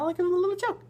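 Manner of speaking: high silly squeak; goofy high voice. Acted. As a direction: squeak it like a joker. Playful voiced line.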